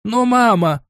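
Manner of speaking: high, pleading cry of protest; a son begging his mother, voice cracking upward; desperate cry. A narrator's reading. Prince cries out to his mother in despair, high-pitched. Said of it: "выкрикнул Август Леопольд с отчаянием в голосе".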